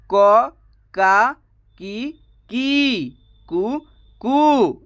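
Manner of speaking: "क", "का", "की", "कु" each said alone, "की" drawn out very slowly; crisp separate syllables, drilling the sounds; syllables spelled out. Shown as a demonstration. Teacher drilling students on the "क" barahkhadi, slow and spelled out. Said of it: "जैसे कि मैं आपको बता दूं".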